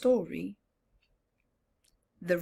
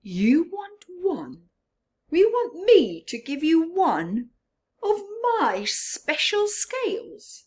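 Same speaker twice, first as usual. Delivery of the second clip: high, loud mocking disbelief; scornful; 8 semitones higher, 7 dB louder than usual. Rainbow Fish scoffing at the little fish, scornful. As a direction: sneer at the request. Proud fish mocks request.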